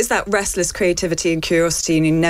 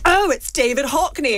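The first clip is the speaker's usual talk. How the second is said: slow, higher braying posh man; posh booming dad voice; 9 semitones higher than usual. Retold or imitated. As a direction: bray it out like a posh father. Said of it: "my dad has a very booming, braying, posh man voice".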